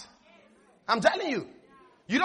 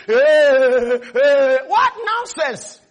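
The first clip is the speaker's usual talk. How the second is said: loud, drawn-out panicky wail; hammed-up frightened moan; mock panic; 5 dB louder, much slower than usual. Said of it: "then you start panicking".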